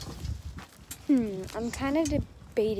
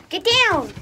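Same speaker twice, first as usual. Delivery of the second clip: sharp command; 6 semitones higher, 6 dB louder than usual. Real, not acted. Dog jumps on her.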